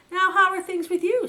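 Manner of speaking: high and loud hearty greeting; hearty chief rabbit. Acted. Chief rabbit asking the visitors their news, hearty. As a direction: boom a cheerful welcome.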